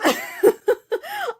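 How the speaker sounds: high, teary burst of laughter; a real laugh spilling over with emotion; emotional laugh. Real, not acted. Author seeing her dress in print, high emotional laugh. Overwhelmed pride over finished book.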